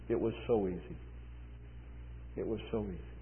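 very soft, low voice; hushed wonder. Retold or imitated. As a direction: barely voice it, head bowed. New convert, head down, marvels.

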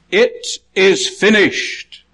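high, very slow cry; loud voice. Acted. Preacher voicing Jesus at his death, slow loud cry. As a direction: call it out slowly, each word set apart. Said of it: "in a loud voice".

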